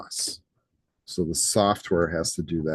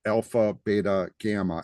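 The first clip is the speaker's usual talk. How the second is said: alpha, beta, gamma in American English, "BAY-ta, GAM-ma"; American pronunciation. Shown as a demonstration. Teacher reading Greek letters to class, American pronunciation. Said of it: "saying it like an American".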